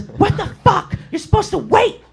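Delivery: very high falsetto, indignant; mock screaming girl. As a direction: imitate an irate customer cussing you out. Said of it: "this girl screaming".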